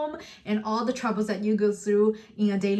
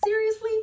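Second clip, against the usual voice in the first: "Seriously?" sent up high as a question; rising intonation; 11 semitones higher than usual. Shown as a demonstration. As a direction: ask SERIOUSLY with the tone going right up. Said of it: "Goes up".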